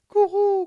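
high loud cooing guru; dove coo. Acted.

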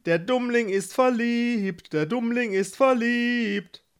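high sing-song playground taunt; teasing. A narrator's reading. Gebhard teases his brother in love, sing-song taunting. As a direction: chant it high to needle him. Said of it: "Gebhard neckte ihn".

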